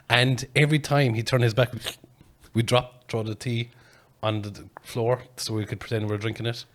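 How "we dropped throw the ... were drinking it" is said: ordinary talk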